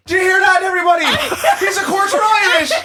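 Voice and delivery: loud, high mock proclamation; Irish bartender. Bartender telling everybody in the pub, mock shouting. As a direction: shout to the room as a hammed-up bartender. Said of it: "he's the bartender".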